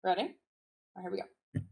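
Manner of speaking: normal talking voice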